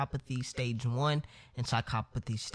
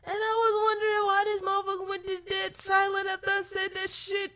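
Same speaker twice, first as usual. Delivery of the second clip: high, teary laugh through the words; crying with laughter; faster than usual. Real, not acted. Telling the story, breaking up in high teary laughter. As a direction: choke out the words between laughs.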